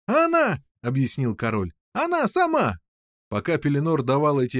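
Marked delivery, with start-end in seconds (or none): 0.0-0.6 very high exclamation; delighted king. A narrator's reading. King explaining to everyone, high excited cry. 1.9-2.8 high-pitched exclamation; delighted king. A narrator's reading. King explaining to everyone, high excited cry.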